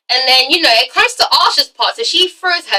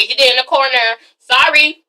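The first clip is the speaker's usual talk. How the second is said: ordinary delivery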